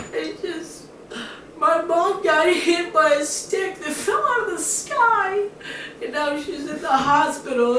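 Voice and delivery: put-on high-pitched tearful little girl wail; hammed-up crying through a high whiny voice; mock bawling. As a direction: blubber it out like a sobbing child. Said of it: "She's just bawling, crying".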